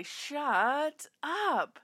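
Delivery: echoing mom's outburst; mock shout. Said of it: "she shared it perfectly".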